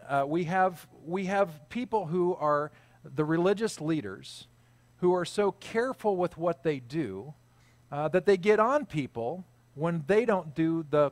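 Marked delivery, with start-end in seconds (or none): none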